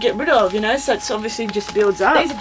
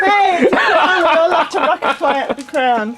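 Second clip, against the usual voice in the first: laughing; 7 semitones higher, 5 dB louder than usual. Real, not acted. Joking about a crown, laughing.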